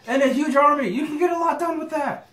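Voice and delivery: high, loud and laughing; laughing excitedly. Real, not acted. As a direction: squeal it through giggles. Laughing excitedly about dragons.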